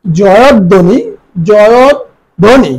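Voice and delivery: জয়ধ্বনি slowly as "jo-yod... dhoni"; careful model pronunciation. Shown as a demonstration. Teacher repeats জয়ধ্বনি for students as "joyod-dhoni", deliberately. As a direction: hold the d in জয়ধ্বনি before "dhoni". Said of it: "আমরা উচ্চারণ করি জয়ধ্বনি".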